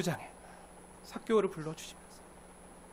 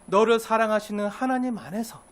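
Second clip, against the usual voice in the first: ordinary talk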